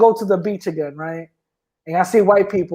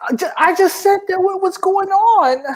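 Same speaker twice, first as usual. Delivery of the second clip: mock innocence; 9 semitones higher than usual.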